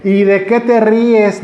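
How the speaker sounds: a mother snapping at her giggling son; scolding mom. Pretend. Preacher playing a mother scolding her laughing child, loud and slow. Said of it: "como cuando las mamás regañan al hijo".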